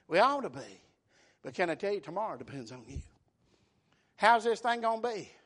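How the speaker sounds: ordinary delivery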